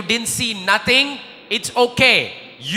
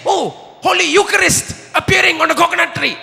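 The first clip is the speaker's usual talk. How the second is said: higher, louder excited shout; excited onlooker; 7 semitones higher, 3 dB louder than usual. Retold or imitated. Onlooker sees the Eucharist on the tree, thrilled. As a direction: cry out in amazement. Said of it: "somebody took another photo and said".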